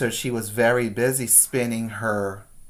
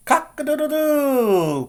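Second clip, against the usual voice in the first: crowing rooster; 12 semitones higher than usual. A narrator's reading. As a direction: crow like a rooster. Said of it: "cried the rooster".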